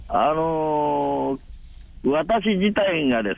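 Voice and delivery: radio show caller=29. Real, not acted. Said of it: "今お電話がつながっておりますので".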